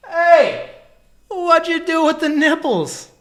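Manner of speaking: high, loud, drawn-out cry of shock; shocked shout. Real, not acted. Host shouts in shock at the robot's bare chest. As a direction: yell it out in disbelief.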